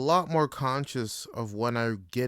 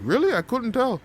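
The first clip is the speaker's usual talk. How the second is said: much higher, louder teasing disbelief; high mock surprise; 12 semitones higher, 7 dB louder than usual. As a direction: squeak out the surprised reply.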